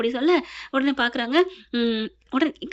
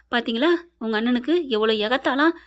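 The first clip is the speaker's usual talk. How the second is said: usual speaking voice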